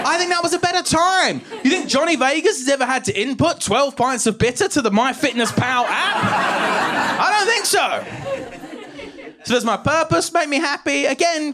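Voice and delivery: high-pitched comic indignation; worked-up mock outrage; indignant rant. Comic rant defending nineties comedians.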